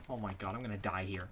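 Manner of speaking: fast, low, under breath; muttered panic; quick panicked mutter. Real, not acted. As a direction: mumble in doom.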